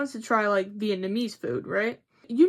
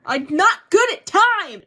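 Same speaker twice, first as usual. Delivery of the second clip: loud, high exasperated burst; loud comic exasperation; 9 semitones higher, 11 dB louder than usual. Real, not acted. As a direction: blurt it out, fed up with herself. Exasperated outburst about dates.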